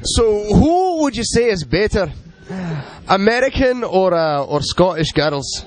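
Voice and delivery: slow, high-pitched bad Scottish accent; horrible Scottish accent. Host quizzing the band in a game, horrible Scottish accent. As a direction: badly fake a Scottish accent, drawing the words out. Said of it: "really horrible Scottish accent".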